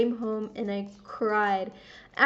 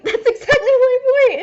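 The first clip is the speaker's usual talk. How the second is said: high, loud laughing repeat; 14 semitones higher, 13 dB louder than usual. Retold or imitated. Laughing repeat of own reply.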